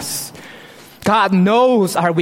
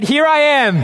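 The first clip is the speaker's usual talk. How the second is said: eager Moses; 7 semitones higher, faster than usual. Pretend. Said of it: "he said".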